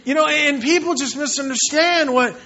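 ordinary talk

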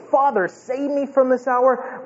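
high, sustained cry to the Father; troubled plea. Acted. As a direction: plead from a troubled soul, pitched high. Reads Jesus's troubled plea.

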